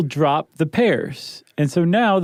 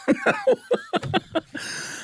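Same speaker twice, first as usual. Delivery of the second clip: high laugh breaking out; burst of laughter; 6 dB quieter than usual. Real, not acted. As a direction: crack up laughing. Laughs at own attempt.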